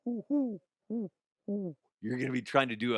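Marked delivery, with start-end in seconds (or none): 0.0-1.8 soft, high hooting; gentle owl call. Shown as a demonstration. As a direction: hoot softly like an owl. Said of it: "It's an owl".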